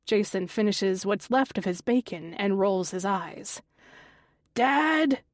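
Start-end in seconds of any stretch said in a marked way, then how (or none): 4.5-5.2 loud call up the house; shouting for dad. A narrator's reading. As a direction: yell for your father downstairs. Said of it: "he shouts as loud as he can".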